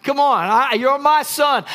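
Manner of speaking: usual speaking voice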